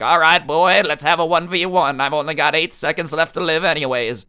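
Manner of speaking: hammed-up grandma picking a fight; Grandma Muriel. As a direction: put on a challenging old grandma. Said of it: "Grandma Muriel might come out of the closet".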